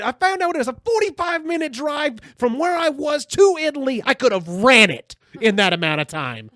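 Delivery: high, very loud imitated rant; John Panette's outraged reaction. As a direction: shout it in hammed-up disbelief. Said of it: "this is his exact reaction".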